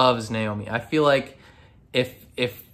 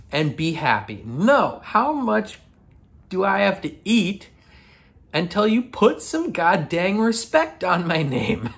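high-pitched, hammed-up outraged rant; pissed off; 7 semitones higher than usual. Drummer explaining her anger to her wife, pissed off. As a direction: build indignantly, pitch climbing toward the demand. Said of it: "why she's so pissed off".

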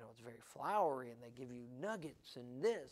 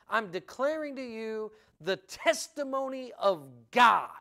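loud, higher, ringing pulpit voice; forceful declaration; 7 semitones higher, 11 dB louder than usual. Shown as a demonstration. Preacher proclaiming the verse to his congregation, forceful. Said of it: "Declaring, declaring unto you".

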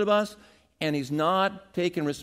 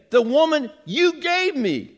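Adam blaming God; 6 semitones higher, 6 dB louder than usual. Retold or imitated. Preacher voices Adam whining to God about the woman, higher and louder. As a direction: sound wronged and put out. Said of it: "He's saying".

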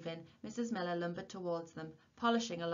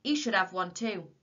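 loud, insistent demand; loud scolding; 9 dB louder than usual. A narrator's reading. Old warden pointing out Willie, loud scolding. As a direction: keep telling him off loudly.

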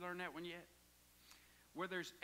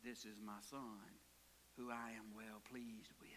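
low, soft whisper of God; a lightly voiced God speaking from the sky; voice from heaven; 10 semitones lower, 10 dB quieter than usual. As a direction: hush it like a voice from above.

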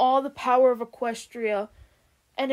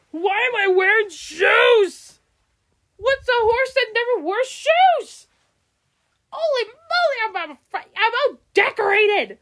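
startled shout; 11 semitones higher, 7 dB louder than usual. Acted. Pony shocked at his shoes.

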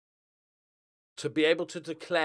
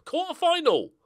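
much higher, louder burst of excitement; excited; 8 dB louder than usual. Real, not acted. Gamer hypes the cup quarter-final, excited. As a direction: announce it with a thrill.

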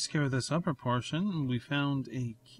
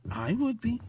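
playful high voice; 10 semitones higher, 4 dB louder than usual. Pretend. Playful self-satisfied retort.

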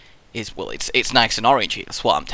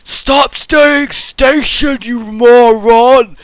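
slow, high, loud mock yell; hammed-up rage at a moron; mock angry comment; 12 semitones higher, 11 dB louder, much slower than usual. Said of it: "Someone actually commented with".

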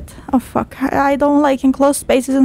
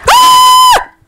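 piercing high shriek; terrified scream; 8 dB louder than usual. Real, not acted. Jump scare in the game, a shrieking scream. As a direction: yelp in sheer fright.

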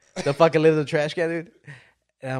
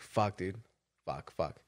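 quiet despairing curse; 7 semitones lower, 11 dB quieter, slower than usual. Retold or imitated. Despair over ruined turkey.

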